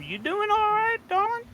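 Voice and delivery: sweet-talking. Acted. Player greeting a shopkeeper in character, high-pitched sweet-talk. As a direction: coo it up high at the end.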